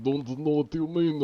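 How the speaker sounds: hammed-up, high Russian speaker; Russian accent. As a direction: put on a comic Russian accent. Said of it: "started going Russian for that".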